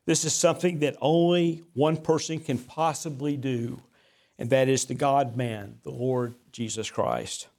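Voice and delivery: normal talking voice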